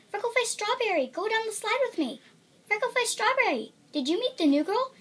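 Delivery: high, eager calls from friends; friends calling. Acted. Friends to the returning girl, high excited calling. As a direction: call out gladly to a missed friend.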